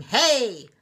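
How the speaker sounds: loud, high street-sweeper shout; hollering. A narrator's reading. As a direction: holler it at the little truck.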